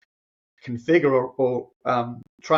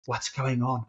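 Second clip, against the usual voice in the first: softer, understated whispered question; retold whisper; 6 dB quieter, slower than usual. Mentor checking on a crying man, retold whisper. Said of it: "he whispered in my ear".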